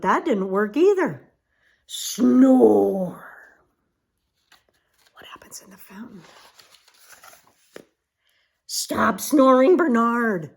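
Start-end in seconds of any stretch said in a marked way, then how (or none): 1.9-3.2 loud snore sound. Acted. Sound effect of snoring. 5.1-6.2 quick whispered question. Real, not acted. 8.7-10.5 annoyed giraffes. Acted. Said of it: "said the giraffes".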